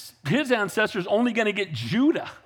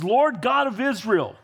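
a touch higher, lifting the name to God; preached prayer; 3 semitones higher than usual. Acted. Solomon kneeling before the crowd, praying in a raised preaching voice. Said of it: "he says".

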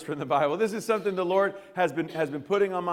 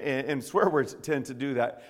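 normal talking voice

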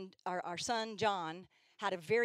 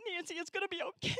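high, whiny seventh-grader comforting; high whiny mock teen voice; whiny teen girl; 8 semitones higher than usual. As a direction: coo it like a whiny young teen. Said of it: "whiny voices".

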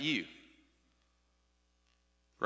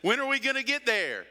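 put-on higher, whiny child asking from the back seat; impatient kid in the back seat; 7 semitones higher than usual. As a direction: nag like a bored kid on a car trip. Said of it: "one of the kids in the back says".

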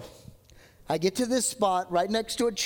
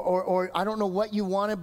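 ordinary delivery

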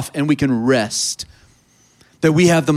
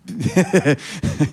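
short chuckle, softer than the talk; self-conscious laugh; 4 dB quieter than usual. Real, not acted. Preacher regretting his translation pick, amused chuckle. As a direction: chuckle at your own choice.

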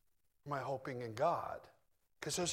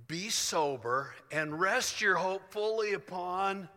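slow, loud, raised proclamation; emphatic scripture reading; 4 semitones higher, 5 dB louder, slower than usual. Acted. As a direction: proclaim each phrase deliberately. Reads scripture aloud emphatically.

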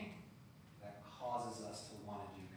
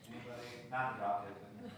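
ordinary delivery

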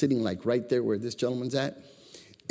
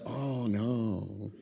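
mock dismay; 3 semitones lower, 8 dB quieter than usual. Acts out a congregation's dismayed response.

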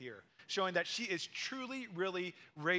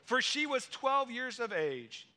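higher, louder, thrilled retelling; rising wonder; 5 semitones higher, 5 dB louder than usual. Acted. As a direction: lift it like a miracle unfolding. Miracle climax, voice rises.

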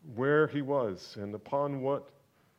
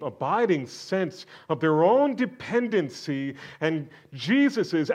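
building preaching; 6 semitones higher, 10 dB louder than usual. Acted. Preacher driving home Jesus' supremacy, building louder. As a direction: build to a shouted climax.

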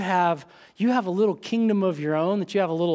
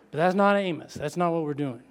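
ordinary talk

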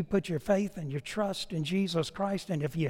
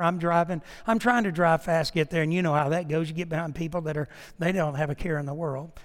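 ordinary delivery

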